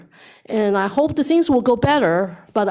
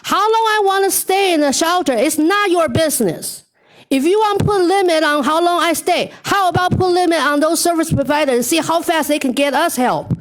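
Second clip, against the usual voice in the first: raised, indignant voice; loud and clear; 6 semitones higher than usual. Retold or imitated. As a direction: call it out, defiant. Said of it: "told her in front of everybody loud and clear".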